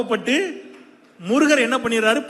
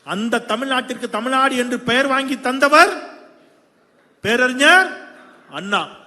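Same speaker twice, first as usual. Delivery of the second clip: loud, raised rally voice; rousing political oratory; 5 semitones higher, 7 dB louder than usual. Real, not acted. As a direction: proclaim it to the whole crowd. Party orator builds to a climax. (உரத்த குரலில் மேடை முழக்கம்)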